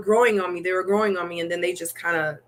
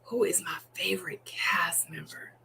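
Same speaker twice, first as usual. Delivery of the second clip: softer whispered musing; whispering to herself; 2 semitones lower, 9 dB quieter than usual. Real, not acted. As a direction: whisper the question to yourself.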